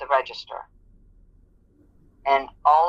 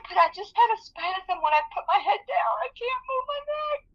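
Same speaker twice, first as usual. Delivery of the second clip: much higher, sobbing cry. Retold or imitated. Injured worker explains the spasm to her boss, sobbing. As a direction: break into sobs at the end. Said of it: "I was crying".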